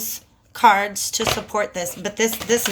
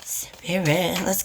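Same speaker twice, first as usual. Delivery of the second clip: whisper; 4 semitones lower, 5 dB quieter, slower than usual. Real, not acted. Whispered call to spirit.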